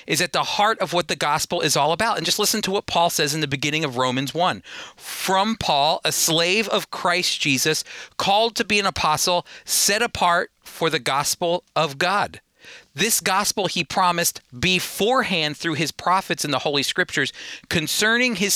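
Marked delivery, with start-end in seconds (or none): none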